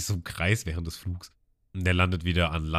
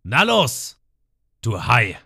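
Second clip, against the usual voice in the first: defiant Ruffy; 3 semitones higher, 7 dB louder, slower than usual. Retold or imitated. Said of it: "Und Ruffy meint".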